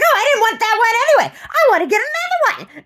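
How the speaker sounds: squeaky imitation of the shopper; mocking the woman. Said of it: "She's like".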